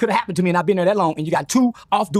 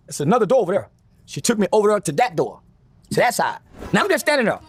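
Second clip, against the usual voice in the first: normal talking voice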